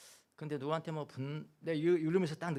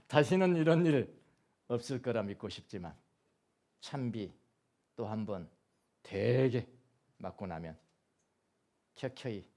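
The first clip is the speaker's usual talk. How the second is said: poem recital; 3 semitones lower than usual. Acted.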